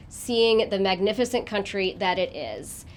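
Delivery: usual speaking voice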